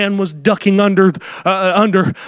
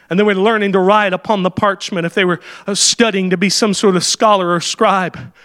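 ordinary talk

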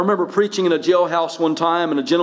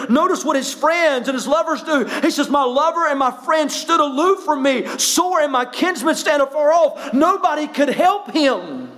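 raised, fervent pulpit voice, pitched up high; full-force preaching; 7 semitones higher than usual. Acted. Pastor to his congregation, fervent full-force preaching. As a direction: preach it to the back pews.

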